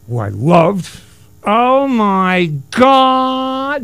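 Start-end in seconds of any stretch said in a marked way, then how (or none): 1.4-3.8 high, slow, drawn-out gasp of Oh my God; Sandy Dennis impression. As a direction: stretch it out breathlessly like a stunned starlet. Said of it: "Nobody said that better than Sandy Dennis".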